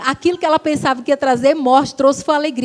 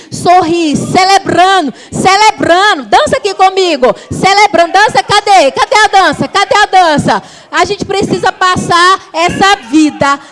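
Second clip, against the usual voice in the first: loud, high, stirring call to celebrate; rallying call; 5 semitones higher, 5 dB louder than usual. Real, not acted. Pastor urging the church to dance and celebrate, loud and high. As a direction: fire the crowd up to dance.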